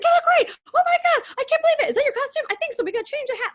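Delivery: high, loud, gushing cast chatter; excited gushing. Retold or imitated. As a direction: squeal over each other's costumes. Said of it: "we're coming down and be like".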